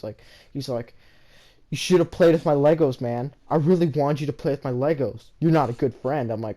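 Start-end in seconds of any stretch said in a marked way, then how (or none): 1.7-6.3 mock bully yelling at the narrator; angry yell. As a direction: yell at him like a hurt bully. Bully confronts narrator at recess.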